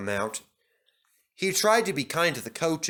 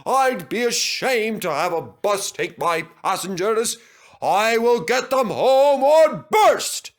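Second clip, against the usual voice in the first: brave promise; 8 semitones higher, 7 dB louder than usual. Acted. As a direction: swear it with all your strength. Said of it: "he promised bravely".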